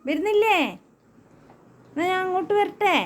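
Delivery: teasing the dog. Real, not acted. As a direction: egg the dog on.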